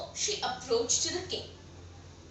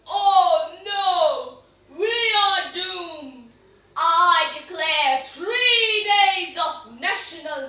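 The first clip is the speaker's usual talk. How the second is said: loud, drawn-out royal wail; dramatic lament; 7 dB louder, much slower than usual. Acted. King declares national mourning to his court, loudly lamenting. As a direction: proclaim it slowly, with booming dismay.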